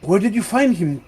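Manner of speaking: a touch of dismayed realisation, lifting in pitch; Matos. Acted. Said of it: "Matos comes out, looks at you".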